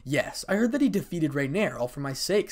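ordinary delivery, nothing marked

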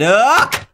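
sharp scream of fright; startled scream. Real, not acted. Gamer screaming at a jump scare.

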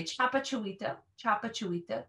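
careful demo of chapa chuita; teacher model pronunciation. Shown as a demonstration. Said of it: "I would say".

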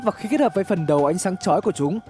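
ordinary delivery

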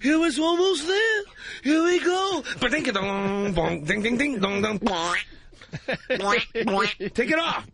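hammed-up Seinfeld sing-song; Jerry Seinfeld impression. Comedian on radio, mock Jerry Seinfeld having sex. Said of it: "Jerry Seinfeld having sex".

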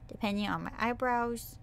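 normal talking voice

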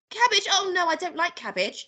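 put-on high child's protest; dismayed boy. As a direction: object to it in a high little-boy voice. Boy objects to cabbage.

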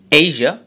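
এই যা with strong এই and weak যা; stress on first syllable. Shown as a demonstration. Teacher repeats the example, এই যা, stressed on এই. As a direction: press on এই, keep যা weak. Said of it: "যা উইক, এইতে স্ট্রেস".

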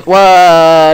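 startled high whoa; loud surprised whoa. Real, not acted. Gamer startled by a leech, loud whoa. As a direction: jolt of surprise. Sudden danger in game.

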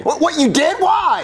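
high-pitched startled questioning; shocked, high demand for an explanation. Acted. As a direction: react in alarm, pitch jumping up. Father shocked by cop's confession.

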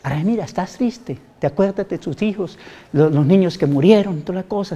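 softly talking to yourself in the mirror; almost a murmur to your own reflection; quiet mirror talk. Shown as a demonstration. Speaking softly to herself in the mirror, quiet. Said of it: "vaya al espejo y diga".

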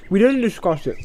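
talking through a mouthful; words round a bite of food; mouth full. A narrator's reading. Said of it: "with his mouth full".

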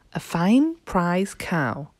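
ordinary delivery, nothing marked

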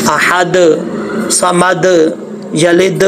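"अहद", "समद", "यलिद" each ending on a bounced d; qalqalah demo. Shown as a demonstration. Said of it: "ये कलकला होता है". ("अहद, समद, यलिद" के आख़िरी दाल पर कलकला करें)

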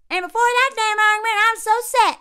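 loud, high, fast Southern twang; parents' Southern accent. Retold or imitated. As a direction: exaggerate a thick rural Southern accent. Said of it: "use the accent of his parents".

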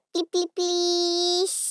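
drawn-out begging; slow pleading. Acted. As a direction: stretch each please, pleading. Kids beg mother.

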